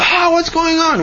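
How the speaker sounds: high, startled yelp; startled cry. Acted. Baker woken by crashing trays, startled. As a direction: jolt awake and cry out.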